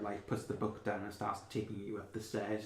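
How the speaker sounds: ordinary talk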